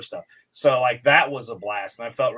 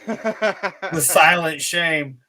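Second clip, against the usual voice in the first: mock dramatic; 4 semitones higher, 4 dB louder, much slower than usual. Dramatic comment on failed joke.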